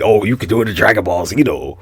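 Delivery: ordinary talk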